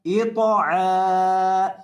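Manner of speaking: अश्तातन read very slowly with each syllable held; drawn-out reading. Shown as a demonstration. Teacher showing students the whole word read slowly. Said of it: "teacher reads joined syllables".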